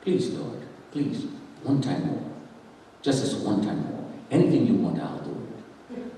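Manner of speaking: very soft, low desperate prayer; hushed crying out. Retold or imitated. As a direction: beg God in a near-whisper. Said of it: "I'm crying out to God".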